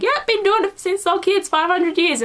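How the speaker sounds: high, breezy voice for Moore; casual Moore. Retold or imitated. A vlogger voicing Moore's shrug about the snowball fight, high and breezy. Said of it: "Moore's just like".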